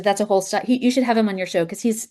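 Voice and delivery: ordinary talk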